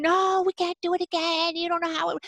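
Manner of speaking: high whining refusal; whiny kid. Retold or imitated. Mother voicing her son's refusal, high and whining. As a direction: mimic the child shutting down every idea. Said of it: "you're like".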